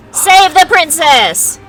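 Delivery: loud, slow heroic call; rallying cry. Real, not acted. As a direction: proclaim it like a battle cry. Rallying choice of action.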